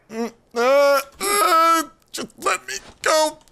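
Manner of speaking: loud, high strained grunts into a shout; frustrated yell. Real, not acted. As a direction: strain and yell to break free. Stuck on mount, exasperated.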